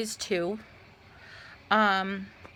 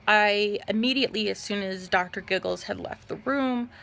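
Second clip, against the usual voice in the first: usual speaking voice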